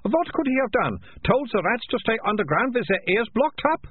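high, carrying mock question; loud. Melissa mocking the piper's accusation, loud and high. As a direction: ask it high for the whole crowd. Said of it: "said Melissa loudly".

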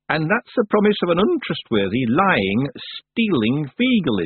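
high, drawn-out contempt; scornful old witch. A narrator's reading. Miss Treason doubting Rob's promise, scornful.

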